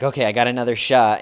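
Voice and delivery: hurried and loud; frantic flustered comic. Retold or imitated. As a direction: rush it, flustered.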